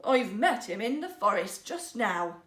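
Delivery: plain reading voice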